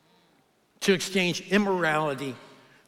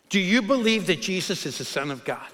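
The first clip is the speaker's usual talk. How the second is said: ordinary delivery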